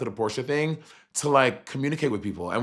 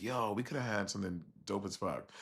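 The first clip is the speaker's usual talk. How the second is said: much softer, muttered under the breath; muttered regret; 3 semitones lower, 14 dB quieter than usual. Retold or imitated. Guest recalling the lost chance with Ron, muttered. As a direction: mutter it to yourself, deflated. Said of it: "'cause I was like".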